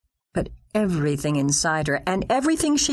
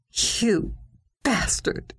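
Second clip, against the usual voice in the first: venomous mutter; 5 semitones higher, much slower than usual. Acted. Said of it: "she muttered with every bit of venom".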